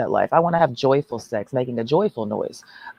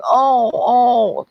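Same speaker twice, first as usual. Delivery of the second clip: mock whimpering; 9 semitones higher, 4 dB louder than usual. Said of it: "crying and whimpering".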